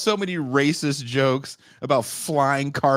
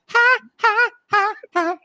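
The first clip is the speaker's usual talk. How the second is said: mock laugh; 5 dB louder than usual. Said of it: "I'm like".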